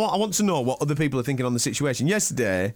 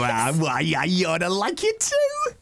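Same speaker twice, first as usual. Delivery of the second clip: uncle punch; slower than usual. Retold or imitated. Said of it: "Did he chuck in an uncle punch".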